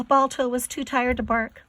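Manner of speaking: ordinary delivery, nothing marked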